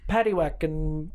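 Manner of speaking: mock British accent. Trading fake British accents.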